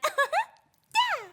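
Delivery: tiny high-pitched cheer; cute character. Shown as a demonstration. Actor showing cute roles they want, high cute cheer. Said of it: "more cute characters who get to be like".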